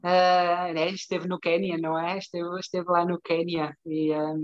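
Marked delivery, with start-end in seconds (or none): none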